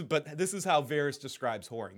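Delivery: ordinary talk, nothing marked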